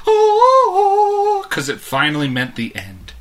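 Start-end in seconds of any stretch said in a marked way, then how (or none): 0.1-1.5 very high, loud, drawn-out mock choir note; mock-heavenly high "ohhh"; heavenly revelation "oh". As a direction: sing out like angels at a holy discovery. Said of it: "It was like we found Christ".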